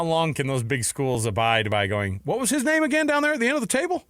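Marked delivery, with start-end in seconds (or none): 2.2-4.0 put-on Southern accent. Big schools snubbing Maryland, mock Southern accent. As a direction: play a clueless Big Ten boss with a Southern accent. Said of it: "give them a Southern accent".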